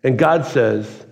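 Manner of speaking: ordinary delivery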